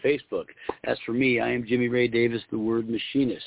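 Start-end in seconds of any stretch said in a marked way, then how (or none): none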